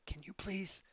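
hushed, urgent request; half-whispered plea. Acted. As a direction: beg quietly over the comms, keeping hidden. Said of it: "kind of a whispered".

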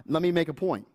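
usual speaking voice

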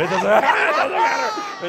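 laughing. Real, not acted. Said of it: "I just messing with you".

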